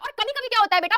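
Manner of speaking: ordinary talk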